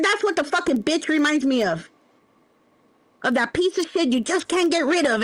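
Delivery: ordinary talk, nothing marked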